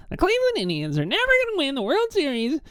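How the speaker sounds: mock the reporter's gloomy call on Cleveland; Tim Kurkjian impression. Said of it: "I have a bad Tim Kurkjian voice".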